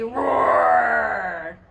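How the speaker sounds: loud pretend lion roar; playful roar. Teacher ending the lion rhyme with a loud roar.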